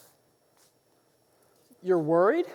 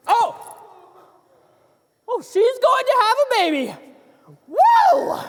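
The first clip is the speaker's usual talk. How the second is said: high, loud, fast realisation ending in a whoop; thrilled cry of a solved riddle; excited outburst; 11 semitones higher, 6 dB louder than usual. Acted. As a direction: burst out with the discovery. Excited guess in charades.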